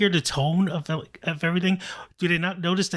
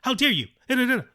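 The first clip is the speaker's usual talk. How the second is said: mock-indignant cartoon judge, pitched up high; high, hammed-up cartoon outburst; Speed Racer character; 9 semitones higher, much faster than usual. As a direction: imitate over-dramatic dubbed cartoon speech. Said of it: "talking like a speed racer character".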